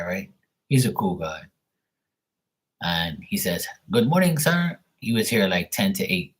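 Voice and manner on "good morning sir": high-pitched mock Filipino accent. Pool guy greeting his customer in the morning, mock Filipino accent. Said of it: "making fun of his voice and his accent".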